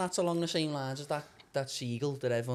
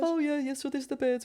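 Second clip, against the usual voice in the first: high mock voice of the fishery man; high-pitched impression of the lad giving a tour; imitating Phil; 11 semitones higher than usual. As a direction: put on a high chirpy voice for Phil.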